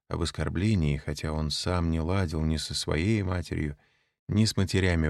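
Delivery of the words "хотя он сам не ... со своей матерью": plain reading voice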